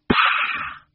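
explosive, voiceless spitting noise; warning cat spit. A narrator's reading. Cat warns off the reaching hand, spitting. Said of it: "replied Georgina warningly".

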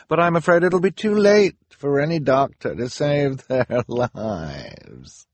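high, loud mock regret; gloating villain. A narrator's reading. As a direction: gloat wickedly, dragging out the last word. Villain gloats over the children's doom.

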